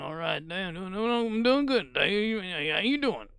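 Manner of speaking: high, looping greeting; hammed-up repeated greeting; imitating Dave. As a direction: stammer the greeting up high, slowing. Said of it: "That's exactly how the guy would sound".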